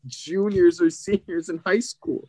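high, laughing put-on voice asking the man; mock polite questioning. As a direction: tease him as if he's lost. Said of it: "And I'm like".

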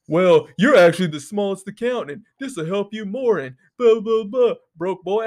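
a hint of a condescending hustler brushing him off; mock know-it-all pitch; mocking the scammer. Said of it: "And then he was like".

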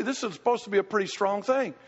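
usual speaking voice

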